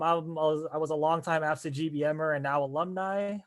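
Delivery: normal talking voice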